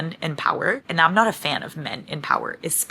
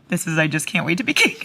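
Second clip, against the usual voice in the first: ordinary talk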